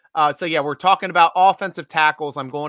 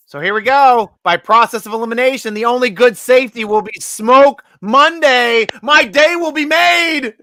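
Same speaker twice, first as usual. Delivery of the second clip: excited; 8 semitones higher, 9 dB louder than usual. Real, not acted. Excited hopeful pick announcement.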